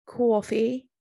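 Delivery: Jersey accent retry. Shown as a demonstration. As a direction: push the Jersey accent on "Coffee" a little harder. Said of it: "I'm trying to do a Jersey accent".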